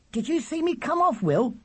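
put-on very high, eager child's voice; piercing little brother. A narrator's reading. Charlie plying his brother with questions, piercing. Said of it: "voice was as loud and piercing as ever".

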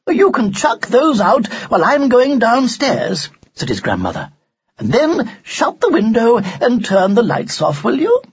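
brisk grandmother. Acted. Grandmother to Ned on her way downstairs, instructing.

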